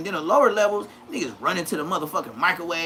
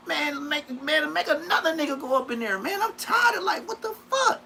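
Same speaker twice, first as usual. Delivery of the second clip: whiny complaint; 10 semitones higher than usual. Retold or imitated. Complaining to the homies about always being picked, high whine. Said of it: "That's what I used to tell him".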